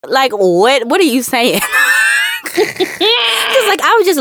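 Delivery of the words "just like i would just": words through a laugh. Real, not acted. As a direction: giggle as you say it. Laughing while talking.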